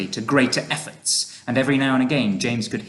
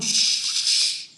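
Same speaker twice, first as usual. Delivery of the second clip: drawn voiceless shush; urgent whispered hush. A narrator's reading. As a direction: shush someone who is panicking. James hushing the earthworm.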